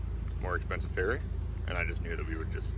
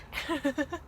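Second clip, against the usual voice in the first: high rising laugh. Real, not acted. Laughing at his failed accent.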